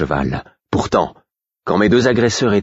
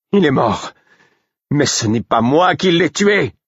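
cry; 10 semitones higher than usual. A narrator's reading. Narrator protesting innocence over the dead king, crying out. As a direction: shout over the fool's wailing. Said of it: "Mon cri couvrit".

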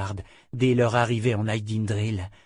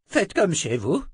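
higher-pitched, mildly tart remark; acerbic; 7 semitones higher than usual. A narrator's reading. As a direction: make it a tart jibe. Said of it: "la remarque acerbe". (acerbe, ton plus aigu)

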